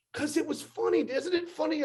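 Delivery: imitating Danny. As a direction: chirp it like a pleased prankster. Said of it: "And he goes".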